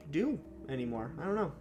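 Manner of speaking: normal talking voice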